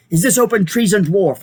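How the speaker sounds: stern challenge. A narrator's reading. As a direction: challenge him as king. King confronting traitor.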